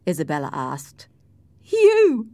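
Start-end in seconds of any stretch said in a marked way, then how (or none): 1.6-2.3 loud, very high exclamation; mocking cry. A narrator's reading. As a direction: cry out in scornful disbelief. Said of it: "cried Cathy".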